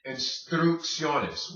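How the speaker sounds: instrucciones slowly, with K then S; measured, careful instrucciones; hard then soft C. Shown as a demonstration. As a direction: draw out een-strook-SYOH-nes in instrucciones. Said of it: "First C hard".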